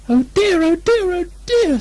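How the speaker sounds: very high, squeaky fretful voice; a tiny frightened pig wringing his hands; high piping worried oh dear; Piglet fretting. A narrator's reading. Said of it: "said Piglet to himself".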